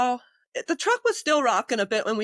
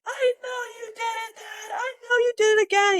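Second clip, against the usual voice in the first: combative patient in the ambulance accusing the medic, shouting angrily; angry patient yell; 12 semitones higher than usual. Retold or imitated.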